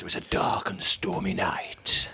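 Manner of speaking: slow spooky storytelling whisper. Pretend.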